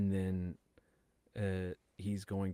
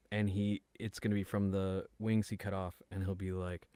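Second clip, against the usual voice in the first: normal talking voice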